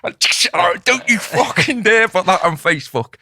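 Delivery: re-enacted indignant telling-off; retold warning. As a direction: warn him off, stretching the words. Retold warning to photo taker.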